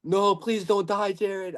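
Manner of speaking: high, drawn-out mock plea; nervous pleading. Fan reliving Jared Cannonier getting dropped, imitated nervous pleading. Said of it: "I was nervous".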